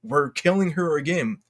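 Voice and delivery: ordinary delivery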